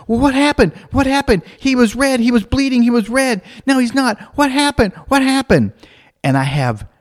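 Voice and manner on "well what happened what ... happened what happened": high-pitched, insistent child impression; imitated kid in urgent confusion; Alex. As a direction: fire questions like a baffled little girl, high. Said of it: "She goes".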